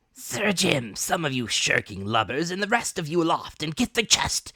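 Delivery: high, loud, cursing rant; swearing at them. Acted. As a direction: berate the lazy crew. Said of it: "swore at them again".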